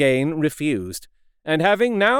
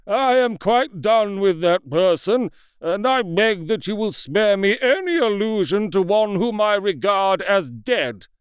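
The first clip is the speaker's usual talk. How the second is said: raised, pitched-up bitter rejection; loud unsteady voice; 6 semitones higher than usual. A narrator's reading. Lanyon disowning Jekyll to Utterson, loud and unsteady. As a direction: cast him off, voice straining. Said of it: "he said in a loud, unsteady voice".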